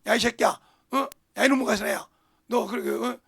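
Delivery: loud mock scolding of the little one. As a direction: bark at the child like an angry parent. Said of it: "이렇게 할까요?".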